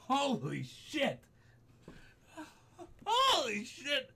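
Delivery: high, slow, drawn-out swearing; nearly crying from the fright; real shaken, tearful exclamation; teary shock. Real, not acted. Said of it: "Got tears in my goddamn eyes".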